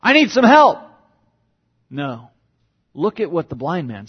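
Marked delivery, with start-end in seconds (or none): 0.0-0.8 casual hail that swells into a high, loud call. Pretend. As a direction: call him over across the crowd. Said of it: "Does he call out".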